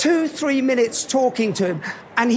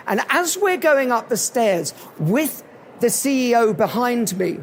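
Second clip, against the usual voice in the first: ordinary delivery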